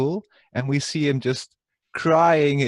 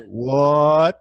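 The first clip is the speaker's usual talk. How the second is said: loud drawn-out rising what; imitating a famous what; 6 dB louder than usual. Re-enacting DLGN's famous reaction, loud baffled what. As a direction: stare at the ceiling and blurt what. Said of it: "D L G N's famous "What?".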